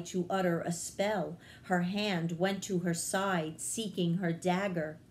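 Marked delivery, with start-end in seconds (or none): none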